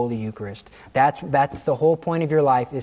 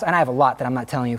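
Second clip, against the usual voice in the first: normal talking voice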